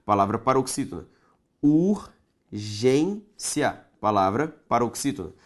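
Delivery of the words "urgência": Urgência stretched very slowly into syllables; teacher separating syllables aloud; syllables pulled apart. Shown as a demonstration. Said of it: "Separe comigo".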